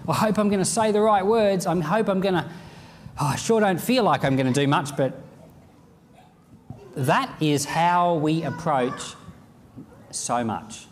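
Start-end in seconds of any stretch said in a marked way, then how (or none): 0.1-5.0 higher, fretful worrying; mock nervous self-doubt; a believer fretting before he prays; anxious inner voice. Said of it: "So you're saying".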